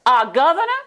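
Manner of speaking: loud, high astonished cry; loud, high Southern-accented shock; surprised outburst. Retold or imitated. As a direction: burst out in disbelief. Arkansas tourists hearing Bill's name.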